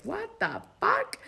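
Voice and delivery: high, quiet, drawn-out disbelief; squeaky-high hushed bafflement; bewildered. Retold or imitated. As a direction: re-enact stunned disbelief, softly and slowly. Retells reacting to a sudden voice.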